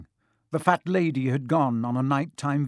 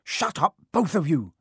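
high, urgent reprimand; brisk, cross command for silence; sharp; 4 semitones higher, 4 dB louder than usual. A narrator's reading. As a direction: cut them off sharply. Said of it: "said Harry sharply".

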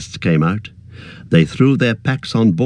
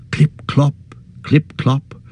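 higher, voiced clip clop; voiced hoofbeats; 5 semitones higher, much slower than usual. A narrator's reading. Narrator voices the rider's hoofs drawing close.

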